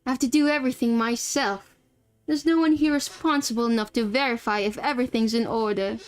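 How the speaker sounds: exasperated man in a put-on British accent; terrible British accent. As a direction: grumble in amateur British English. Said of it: "this is my terrible British accent".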